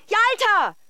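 high, loud frantic call across the street; calling a lost dog, searching desperately. Acted. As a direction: shout the dog's name in panic.

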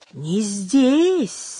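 mysterious. Acted. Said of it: "заметила старуха таинственно".

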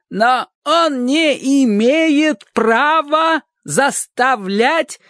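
slow, loud, high-pitched tirade; roaring. Acted. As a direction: shout each word slowly in her face. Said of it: "проревела она".

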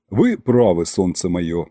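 musing, sinking low at the end; thoughtful concession. A narrator's reading. Ambassador agreeing with his sweetheart Masha, thoughtful. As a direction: concede thoughtfully, dropping pitch on the endearment. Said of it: "задумчиво осмотрел меня".

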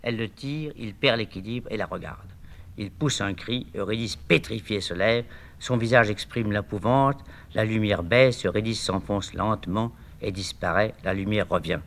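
low, quiet narration of directions; hushed stage-direction read. A narrator's reading. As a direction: read the directions softly and evenly.

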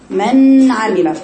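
من عمل dragged out very slowly with the نون swallowed; مد "من عمل" ببطء مع إخفاء النون; slow blurred izhar. Shown as a demonstration. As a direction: stretch من عمل and hide the نون. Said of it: "فمتبينش".

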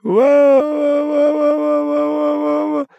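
high slow wailing howl. A narrator's reading. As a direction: howl in growing terror. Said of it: "завыл кто-то".

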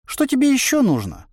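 high-pitched puzzled inner voice; surprised. A narrator's reading. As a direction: ask in a high, incredulous lilt. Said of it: "удивился голос". (удивлённо, высоким голосом)

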